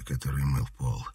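usual speaking voice

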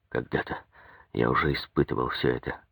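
low, hushed musing; inner thought. A narrator's reading. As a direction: think aloud softly, as if to oneself. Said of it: "мелькнуло у него в сознании". (тихо, низко, как мысль про себя)

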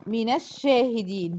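slow "من الشاهدين" as mina-shshahidin; measured tajweed example, "من الشاهدين" joined by a; demonstrating the fatha link. Shown as a demonstration. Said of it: "زي ما أقول".

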